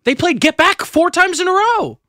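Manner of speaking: very high-pitched disbelief; incredulous. Real, not acted. Podcast guest complains about the concert setlist, incredulous. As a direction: pitch jumps up in exasperated amazement.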